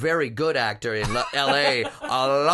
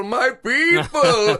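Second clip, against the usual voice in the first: louder and slower, drawn out; emphatic, drawn out; 9 semitones higher, 7 dB louder, much slower than usual. Retold or imitated. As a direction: stretch out "my people" with feeling. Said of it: "I said".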